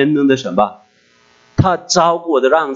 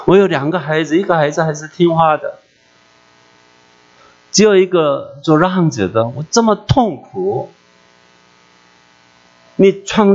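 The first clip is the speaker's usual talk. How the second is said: mild grieving plea; 4 semitones higher than usual. Retold or imitated. Pouring out her heart to God.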